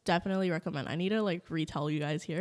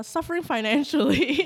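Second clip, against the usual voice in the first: laughing; 7 semitones higher, 8 dB louder than usual. Real, not acted. Joking about being a broke student.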